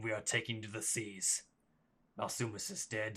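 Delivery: understated commander giving orders in measured phrases; a hint of a solemn war leader; lightly voiced bandit chief. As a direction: address your men as their chief, restrained, with pauses. Voices chief's speech to his men.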